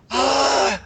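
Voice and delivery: high wheezing gasp; an asthmatic wheeze; wheezy voice. Shown as a demonstration. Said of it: "Wheezy's more like, you know, asthmatic".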